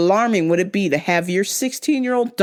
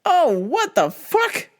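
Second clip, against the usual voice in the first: mock disbelief; 12 semitones higher than usual. Retelling her reaction to the coworker, in high mock disbelief. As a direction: act stunned at him, voice jumping up. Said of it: "And I'm just like".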